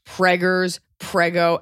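ordinary talk

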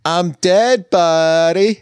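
high, drawn-out impersonation voice; Pauly Shore. Acted. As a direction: put on a slow, high-pitched comic character. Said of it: "Pauly Shore movie".

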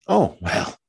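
fretful self-pitying young wife, lightly voiced; Mary's complaining voice. Mary complains to Anne about being left alone, lightly whiny.

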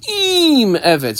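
"Im" held high, Eved dropping after it; stress on Im. Shown as a demonstration. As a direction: lift and lengthen "Im". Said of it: "emphasis on the word Im".

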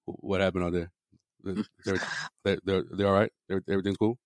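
lightly done low, soft E-Man voice; E-Man impersonation. Retold or imitated. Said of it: "Don't get me started with an E-Man impersonation".

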